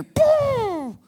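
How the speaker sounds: a sudden high loud crowd boo; mock boo. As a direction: boo yourself like a heckler. Mock booing his own past self.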